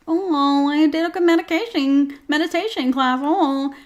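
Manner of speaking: high, put-on mocking voice; mock a person swooning over meditation class; gushing meditation-class person. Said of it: "I'm that person who's like".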